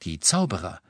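ordinary delivery

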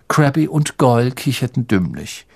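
usual speaking voice

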